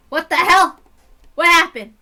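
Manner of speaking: loud, high what the hell; startled outburst. Real, not acted. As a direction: blurt it out, caught off guard. Reader reacts to surprise.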